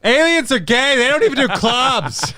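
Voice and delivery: mock high voice. Jokey flamboyant voice.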